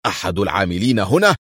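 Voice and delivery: indignant echo as a rising question; angry. A narrator's reading. Officer interrupts on hearing of the thief, angry. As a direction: cut in with angry disbelief. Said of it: "قاطعه الضابط السوفيتي في غضب".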